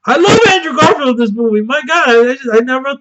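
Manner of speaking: high, quick burst of fandom; excited fan outburst; gushing excitement. Real, not acted. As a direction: blurt out your love, pitched right up. Sudden gush about a favourite actor.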